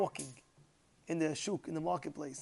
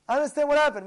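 high, raised-voice acted reply; loud retort; 8 semitones higher, 9 dB louder, faster than usual. Rabbi answers the confused gabbais, loud retort. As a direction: project it high and forceful.

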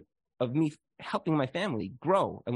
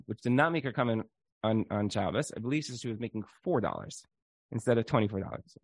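ordinary delivery

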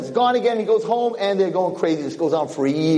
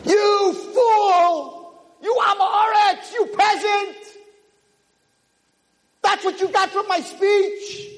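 furious scolding. Acted. Rabbi berating the peasant for his foolishness, furious. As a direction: tear into the fool.

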